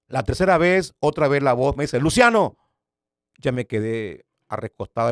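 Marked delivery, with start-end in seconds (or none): 2.0-2.6 loud high call of the name. Retold or imitated. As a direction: call the name a third time, loudly. Third call of the voice. (llamada fuerte y aguda)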